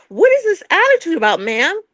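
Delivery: high-pitched, incredulous questioning; exasperated challenge. Real, not acted. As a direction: demand an explanation, pitched well up. Challenges co-host about her attitude.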